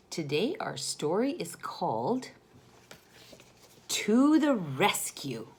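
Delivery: ordinary delivery, nothing marked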